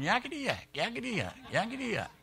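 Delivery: high, thin, taunting imp chatter; hammed-up little monkey demon jabbering; hollering imp. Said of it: "that demon was jumping up and down hollering".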